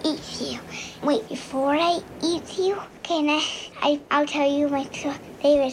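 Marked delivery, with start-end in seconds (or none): 0.0-0.6 unvoiced whisper, spooky and close; shark whisper. Child shark whispering a threat to the listener. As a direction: breathe it like a sneaky shark.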